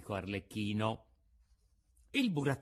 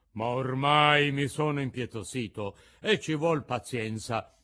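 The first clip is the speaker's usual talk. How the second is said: loud blustering showman; a big gruff puppet master grumbling, then calling out; 4 semitones higher, 6 dB louder than usual. A narrator's reading. Mangiafoco tells Pinocchio he will burn another puppet, loud gruff voice.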